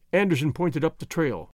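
ordinary delivery

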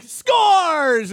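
loud announcer "Scores!"; play-by-play call. Retold or imitated. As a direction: belt it like a goal call. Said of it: "you're like".

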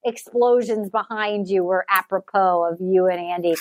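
scornful retort aimed at the bachelor; sarcastic mock reply. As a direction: talk down to him like a dum-dum. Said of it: "I'm like".

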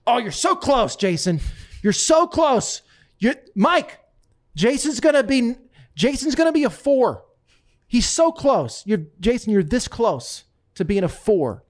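loud, high, over-the-top flattery; gushing Trump. Retold or imitated. To Jason after a bad shot, loud gushing praise. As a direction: gush at his buddy like a fawning fan.

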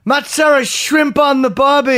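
high, loud, slow hammed-up Australian accent; a deliberately terrible Australian accent, loud; mocking Australian fans with a loud, slow Australian accent; bad Australian accent. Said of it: "terrible accent".

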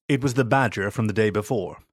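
ordinary delivery, nothing marked

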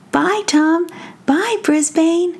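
put-on a small child waving bye. Kids say bye.

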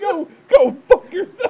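high laughing politician voice; Kamala Harris. Retold or imitated. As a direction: play a prim politician cursing. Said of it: "Picturing Kamala Harris typing this out".

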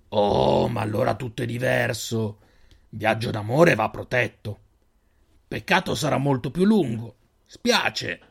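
slow, guarded stranger probing; a lightly voiced suspicious desert man; wary stranger. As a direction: size up the strangers slowly. Stranger feigns good wishes.

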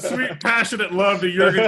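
laughing through the words. Real, not acted. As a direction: giggle while saying it. Repeats his line while laughing.

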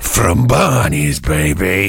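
slow, low, drawn-out artist drawl; cool artist voice. Pretend. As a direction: play the smug artist, lay it back slow. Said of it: "I'll be the artist".

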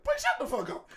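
very high-pitched mock hush; Santa. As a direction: squeak out an imitated telling-off, amused. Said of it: "And then Santa was like".

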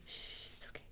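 hushed whisper. Real, not acted. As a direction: whisper it soothingly. Hushing quietly aside.

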